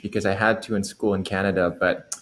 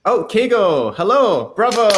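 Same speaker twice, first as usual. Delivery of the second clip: higher and louder, thrilled hello; excited greeting; 9 semitones higher, 7 dB louder than usual. Real, not acted. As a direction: cheer him on as he joins the stream. Greeting the winner on stream.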